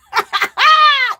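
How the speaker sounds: high, loud real laugh; loud burst of laughter. Real, not acted. Host cracking up at a viewer's boring shawl, loud laugh. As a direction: crack up loudly, pitch jumping up.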